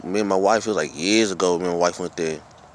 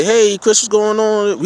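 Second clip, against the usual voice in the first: high, cheery greeting to a friend; a bright, easy hey-Chris hello; friendly hello; 10 semitones higher, much slower than usual. Pretend. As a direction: greet him like an old buddy. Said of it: "Don't say".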